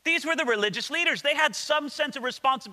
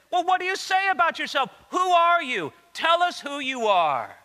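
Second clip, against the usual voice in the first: higher-pitched, pressing exasperated questions; 7 semitones higher, 3 dB louder than usual. Retold or imitated. Religious leaders pressing John for an answer, exasperated. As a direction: demand an answer, patience running out. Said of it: "with maybe a sense of exasperation".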